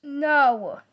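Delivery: sharp loud no; loud refusal. Pretend. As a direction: shut it down firmly. Refusing friend's sleepover.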